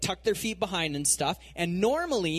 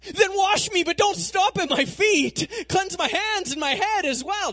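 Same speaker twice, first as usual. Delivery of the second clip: much higher and louder, gushing; eager turnaround; 6 dB louder than usual. Retold or imitated. Peter flips to eager pleading, high and loud. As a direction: Peter begging Jesus to wash him all over, eager. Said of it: "complete one eighty".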